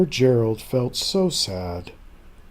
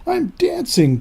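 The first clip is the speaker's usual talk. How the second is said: rising, much higher and louder with every I'm dancing; joyful cry; 11 semitones higher, 4 dB louder than usual. Acted. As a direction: burst out in delighted disbelief. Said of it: "Gerald cried".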